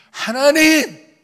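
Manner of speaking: hammed-up royal outburst, shouted far above normal pitch; crying out to God. Preacher complaining to God about his dilemma, shouting in protest. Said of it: "단종이야 하는 것처럼".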